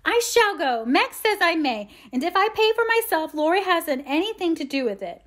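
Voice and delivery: high, loud bratty defiance; high-pitched, provoking insistence; aggravating. A narrator's reading. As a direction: needle an older sister with it. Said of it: "in her most aggravating way".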